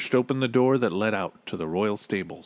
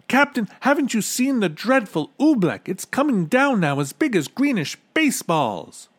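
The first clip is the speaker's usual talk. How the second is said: high, loud urgent warning; high-pitched boy in alarm; 12 semitones higher, 5 dB louder than usual. A narrator's reading. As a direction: warn him frantically. Bartholomew urgently warns captain.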